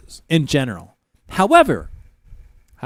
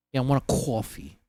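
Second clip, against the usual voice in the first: low, soft New York accent with "caw-fee"; 4 semitones lower, 11 dB quieter than usual. Shown as a demonstration. Ordering in a central New York accent. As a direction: lean on the Brooklynese "coffee" vowel. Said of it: "the central New York accent".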